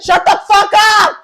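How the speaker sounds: very loud, high, drawn-out shout; exaggerated exasperated yell; mock yelling son. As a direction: bellow back at a crying mom, hammed-up. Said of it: "And then he yells at her".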